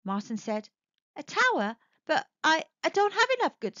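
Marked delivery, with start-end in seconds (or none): 1.1-3.8 high, startled disbelief; surprised. Acted. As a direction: voice jumps up in shock. Martin stunned by request.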